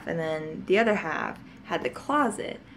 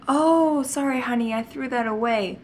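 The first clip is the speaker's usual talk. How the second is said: higher, louder sweet mom; 5 semitones higher, 4 dB louder than usual. Retold or imitated. Mom lightly telling her daughter the toy is gone, sweet and higher. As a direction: apologise lightly to a child.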